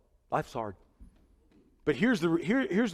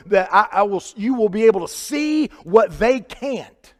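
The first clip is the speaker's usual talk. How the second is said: louder, higher pulpit delivery; emphatic, raised sermon voice; impassioned preaching; 6 semitones higher, 7 dB louder than usual. Real, not acted. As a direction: preach the promise with force. Preaches at full force.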